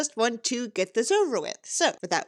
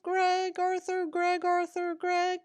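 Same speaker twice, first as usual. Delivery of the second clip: 1 mocking sing-song; 9 semitones higher, slower than usual. Mocking question at the author.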